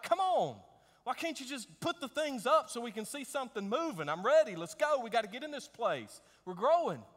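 high, loud impatient pleading; frustrated. Retold or imitated. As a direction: whine it out, fed up with waiting. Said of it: "I'm getting frustrated".

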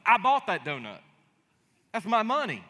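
hammed-up fatherly indignation; mock indignant dad. Dad turning the tables on his kid, hammed-up loud indignation. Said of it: "I'm gonna say".

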